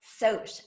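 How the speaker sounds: "Soch" said as sohsh, with a long o; correct pronunciation. Shown as a demonstration. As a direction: say "Soch" like the first syllable of socialize. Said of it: "as in socialize".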